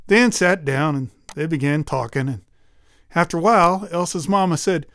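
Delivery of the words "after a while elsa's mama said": ordinary delivery, nothing marked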